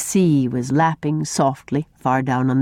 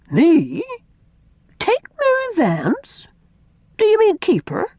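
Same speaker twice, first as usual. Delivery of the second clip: pitched much higher, disbelieving questions; astonished. A narrator's reading. Miss Cornelia, stunned by Una's request, astonished. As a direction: sound floored by the idea.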